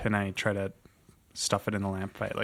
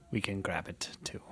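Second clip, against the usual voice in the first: whisper; 11 dB quieter than usual. Acted.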